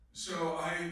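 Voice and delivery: ordinary delivery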